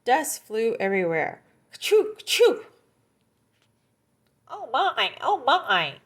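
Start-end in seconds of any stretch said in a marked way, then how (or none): 1.7-2.7 loud high mock sneezes; big cartoon sneeze; sneezing. Coyote sneezes in dust. 4.5-5.9 higher fussy little voice; startled small animal; a fussy gopher. Acted. Gopher pops out of hole.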